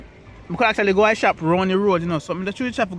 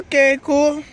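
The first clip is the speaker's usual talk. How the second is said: surprised; 8 semitones higher, 5 dB louder, slower than usual. Retold or imitated. Retold stunned reaction.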